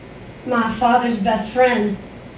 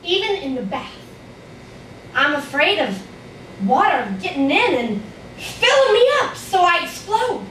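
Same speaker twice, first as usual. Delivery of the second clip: put-on frightened little child narrator; 2 semitones higher than usual. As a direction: tell it as a worried five-year-old.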